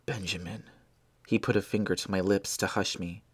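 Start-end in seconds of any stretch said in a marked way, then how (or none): 0.0-0.6 soft urgent whisper; dying whisper. Acted. Dying Blair to Benjamin, a weak urgent whisper. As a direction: press him to go, barely voiced.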